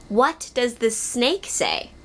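ordinary talk

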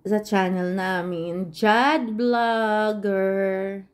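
very slow, stretched syllables; drawn-out plug. Real, not acted. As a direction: stretch out each phrase like a lingering sign-off. Drawn-out sign-off plea to subscribers. (mabagal at pahabang panawagan)

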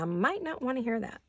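ordinary delivery

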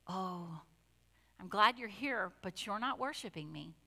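soft, understated imagined voice of the Lord; hushed, gently disappointed aside; gentle, not singsong. Retold or imitated. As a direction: say it quietly, without the singsong. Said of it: "I don't think he does it in that high-pitched, singy, songy voice".

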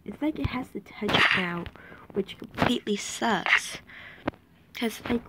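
frustrated whisper. Real, not acted. Frustrated muttering at redstone.

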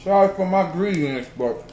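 talking while chewing; muffled chewy speech; mouth full of popcorn. Real, not acted. Seen 2: Hand-to-mouth eating just before; mouth pursed, working while talking early.

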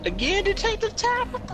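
very high squeaky incredulous question; exasperated mock disbelief. Exasperated at teammate caught again.

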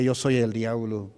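mild mock devil voice; possessed woman. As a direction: retell the devil speaking through her. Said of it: "salió una mujer gritando y gritaba terrible".

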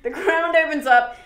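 loud, punchy dramatic retelling. A narrator's reading. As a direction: hit it like a shock reveal. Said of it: "It's really dramatic".

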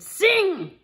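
loud, high one-word order; ogre's command. Acted. Ogre commands the golden harp, loud and sharp. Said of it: "and he said".

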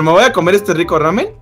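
ordinary talk, nothing marked

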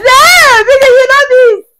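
very high, loud wailing protest; loud, high-pitched disbelief; outraged outcry. Acted. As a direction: cry out in hammed-up indignation. Mock outrage at [name]'s remark.

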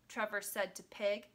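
ordinary delivery

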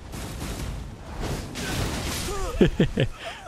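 breathy chuckles climbing in pitch; long giggling laugh. Real, not acted. Player amused mid-fight, long breathy giggling.

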